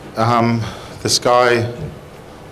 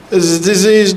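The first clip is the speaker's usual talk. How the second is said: anxious pleading; 6 semitones higher, 3 dB louder than usual. Acted. Anxious patient questions doctor.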